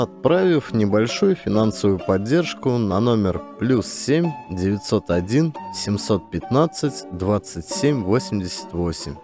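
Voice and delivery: ordinary delivery, nothing marked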